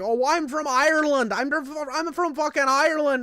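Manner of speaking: hammed-up angry Irish accent; irate Irishman impression. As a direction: protest like an offended Irishman. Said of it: "dude goes off on me".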